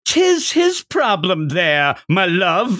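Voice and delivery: slow, high mock Irish lilt; Irish accent. Power teasing Denji in an Irish accent. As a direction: put on a stage Irish accent for "my love". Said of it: "Power starts developing an accent".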